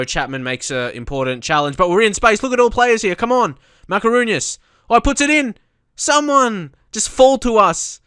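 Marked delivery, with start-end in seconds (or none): 1.8-8.0 high, loud match-commentary excitement; excited yelling. Real, not acted. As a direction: yell at the screen as chances come. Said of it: "I just reacted so badly".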